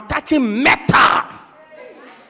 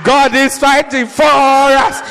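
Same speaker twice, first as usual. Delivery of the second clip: slow, loud, drawn-out cry; acted rallying shout; 3 semitones higher, 8 dB louder, slower than usual. Pot-bellied older man moving along, mock fervent shout. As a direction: bellow it in mock fervour, stretching each word.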